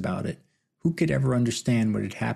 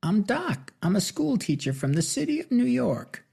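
much higher screechy movie-quote voice; 11 semitones higher than usual. Acted. Big man quotes Young Guns Two in screechy voice. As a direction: squeak the line out like a movie mimic. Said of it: "In a screechy voice mimicking a line".

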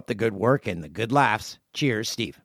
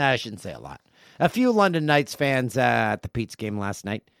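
ordinary delivery

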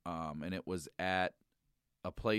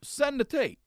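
exasperated; 5 dB louder, slower than usual. Retold or imitated. Retold exasperated demand.

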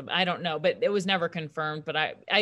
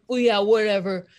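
dismissive; 3 semitones higher, 8 dB louder than usual. Retold or imitated. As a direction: brush it off loudly. Dismissive guys.

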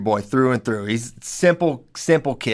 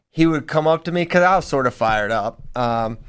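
ordinary talk, nothing marked

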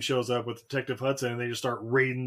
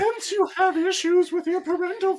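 Deanna Troi voice; 4 dB louder than usual. Acted. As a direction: draw it out like a TV empath. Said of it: "Deanna Troi voice".

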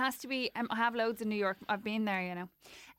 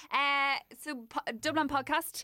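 ordinary talk

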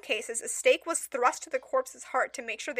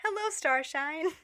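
playful night greeting; 3 semitones higher, slower than usual. Pretend.

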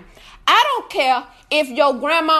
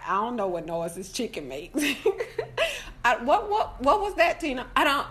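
normal talking voice

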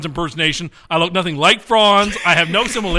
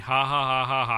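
flat spoken mock ha ha; deadpan fake laughter; sarcastic laugh; 7 semitones lower, 8 dB quieter than usual. Real, not acted.

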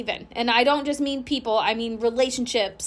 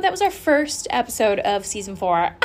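ordinary delivery

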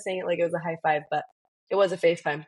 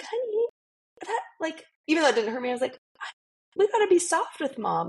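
gentle mom voice; 10 semitones higher than usual. Retold or imitated. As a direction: coax your little boy kindly.

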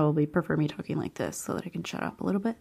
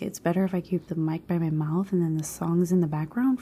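usual speaking voice

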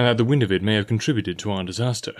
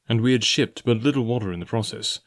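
usual speaking voice

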